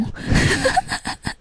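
squeaky breathless laugh; high airy laugh. Real, not acted. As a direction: burst into a high giggle. Laughs at partner's jab.

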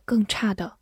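ordinary talk

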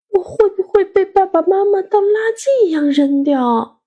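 high-pitched, wounded worry; sad child. A narrator's reading. Child hiding in the bedroom fearing abandonment, sad. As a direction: fret to yourself like a hurt child. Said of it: "伤心地想".

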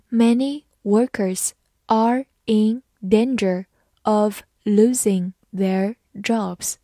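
slow, word-by-word model reading. Shown as a demonstration. Teacher reads the last example for learners, slowly. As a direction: leave a gap after each word, unhurried. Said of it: "好，慢慢来读".

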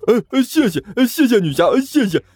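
high, stammering thanks; grateful thug. Acted. Thug thanking the heroine, high stammer.

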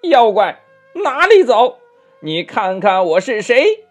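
high, loud Monkey King shout; shouted challenge. A narrator's reading. Monkey King stopping the fleeing demon, shouting high and loud. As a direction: call out sharply to stop him. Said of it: "喝道呀".

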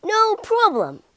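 high, cocky warthog brag; swaggering and high; bragging. A narrator's reading. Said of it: "he bragged".

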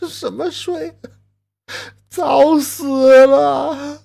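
high, slow, drawn-out wail; grim lament. Acted. Grieving husband telling the neighbour his wife is dead, slow wail. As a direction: stretch out the grief.

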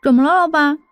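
mumbled round a lollipop; talking with candy in mouth; mouth full. Acted. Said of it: "含糊不清地说". (嘴里含着棒棒糖含糊地说)

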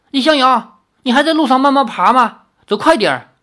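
high, loud call down the road; acted anxious, impatient holler. A narrator's reading. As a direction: yell to hurry someone along. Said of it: "起身叫道".

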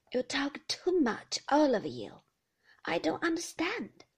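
lightly voiced, querulous old woman; a confused old grandmother complaining; fretful old lady.

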